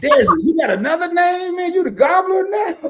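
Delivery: high, loud laughing tease; teasing a friend through real laughter. Real, not acted. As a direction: laugh out the question at the new nickname. Laughing at Desmond's new nickname.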